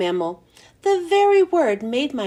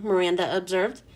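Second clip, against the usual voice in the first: plain reading voice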